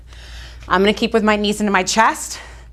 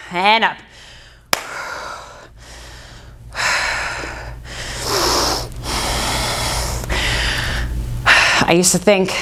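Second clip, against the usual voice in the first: normal talking voice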